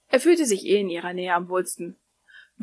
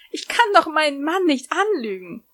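higher, pleading objection; tearful protest; 8 semitones higher than usual. Acted. Lucy tearfully objecting to Natsu's comforting lie. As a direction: protest through tears. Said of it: "widersprach Lucy".

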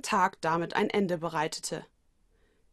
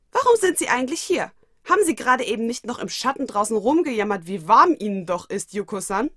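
loud, high, accusing questions; loud, irritated confrontation; exasperated reproach; 3 semitones higher, 6 dB louder than usual. Acted. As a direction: call her out for whining. Watanuki annoyed at lazy boss.

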